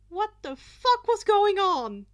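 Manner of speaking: bewildered. Acted. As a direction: shout in confusion. Bakugo's bewildered thought.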